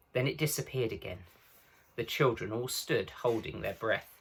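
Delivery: ordinary delivery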